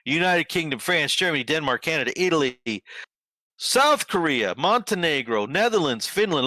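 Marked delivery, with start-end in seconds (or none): none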